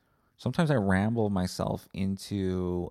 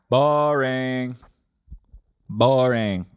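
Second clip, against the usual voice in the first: loud, higher, drawn-out jeer; heckling from the back; 4 semitones higher, 7 dB louder, much slower than usual. Acted. Retreat heckler at the back, loud drawling mock call. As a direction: call it out long and loud across the room. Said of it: "I'm just at the back".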